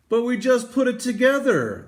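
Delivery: high, loud protesting workers; indignant workers. Acted. Workers protest taking the statue apart, high indignant cry. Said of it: "the workers said".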